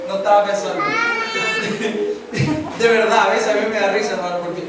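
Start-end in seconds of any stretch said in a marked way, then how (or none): 0.9-2.7 high laugh. Real, not acted. Laughs at himself.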